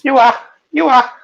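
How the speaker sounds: high, loud Liverpool caricature; shrieky imitation of Liverpool speech; mock Liverpool accent. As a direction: squawk it like a caricatured Scouser. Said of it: "you think they're talking another language".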